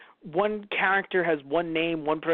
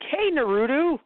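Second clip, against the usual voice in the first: excited call; 9 semitones higher, slower than usual. Retold or imitated. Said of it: "everyone else is like".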